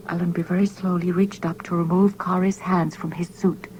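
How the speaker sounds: ordinary delivery, nothing marked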